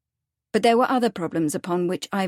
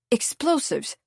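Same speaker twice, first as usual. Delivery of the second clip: sharp, higher startled question; sharp interruption; 4 semitones higher, slower than usual. A narrator's reading. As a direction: cut in sharply, caught off guard. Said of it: "Ivan had interrupted sharply".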